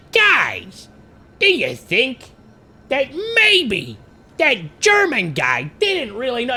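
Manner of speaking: lightly voiced, musing to the guys; Guilford Godfrey voice. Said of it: "doing the Guilford Godfrey voice".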